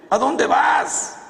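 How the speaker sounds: sudden loud high shout, slow; mock yell. Priest yelling after the silver-painted woman in a loud, high shout. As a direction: bellow it after her as she walks off.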